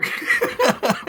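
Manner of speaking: a sudden, high-pitched laugh; high burst of laughter. Real, not acted. Telling the bear story to a friend, a sudden, high-pitched burst of laughter. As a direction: crack up at the memory.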